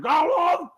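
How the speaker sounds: high, loud babbling gibberish; lizard gibberish. Acted. Lizard in the water babbles back loudly. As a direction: babble nonsense like a creature.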